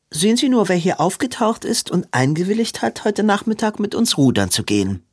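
put-on high, gushing society girl; bubbly Belinda.

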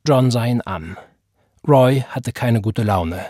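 ordinary delivery, nothing marked

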